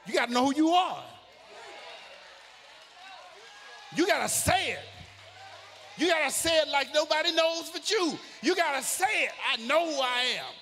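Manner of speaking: full-force preaching. Acted. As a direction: preach it out to the rafters.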